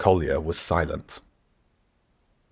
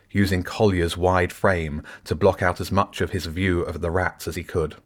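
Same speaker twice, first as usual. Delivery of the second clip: ordinary delivery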